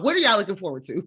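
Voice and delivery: fast question through laughter; laughing question. Real, not acted. Host handing over to the group, laughing. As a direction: toss it to the group while giggling.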